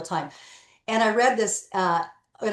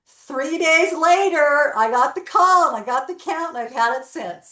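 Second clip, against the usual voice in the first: triumphant reveal; 6 semitones higher, 5 dB louder than usual. Real, not acted. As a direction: land the payoff with pride, drawing it out. Triumphant payoff of the story.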